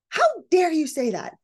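high-pitched mock outrage; blowing up. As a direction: act out snapping back at a rude uncle. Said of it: "Let's say you blow up at Uncle Jimmy".

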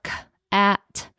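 put-on a child sounding out a word; sounded out. Shown as a demonstration. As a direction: split into sounds. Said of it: "they sound it out".

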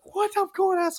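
very high, startled disbelief; acted-out shock at being called up; shocked question. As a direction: squeak it out in mock panic. Acts out shock at being next.

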